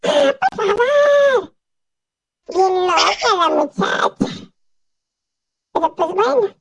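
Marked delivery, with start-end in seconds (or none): 0.0-1.5 high, drawn-out mock cry; retold mock yell. Mimicking Mamá yelling in her sleep, high mock cry. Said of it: "y grita".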